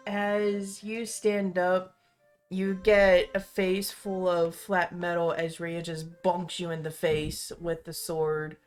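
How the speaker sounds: usual speaking voice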